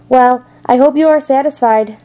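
rat's understated sneer. A narrator's reading. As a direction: say it with a light sneer. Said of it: "sneered the rat".

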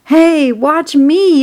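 high, loud, slow call-out; boy's yell. A narrator's reading. Boy showing off to onlookers, yelling. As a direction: yell for everyone's attention, stretching the words. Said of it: "yelled Avery".

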